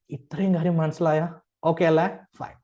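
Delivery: ordinary talk, nothing marked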